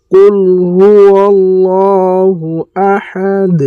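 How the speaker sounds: slow melodic tajweed chant; measured Quranic recitation. Shown as a demonstration. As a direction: recite slowly, drawing out each vowel. Reciting the verse.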